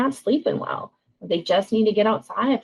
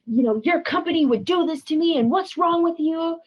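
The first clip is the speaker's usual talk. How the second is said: higher-pitched mock tirade; acted angry outburst; 8 semitones higher than usual. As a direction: play someone fuming and pounding the table. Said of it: "pounding on the table".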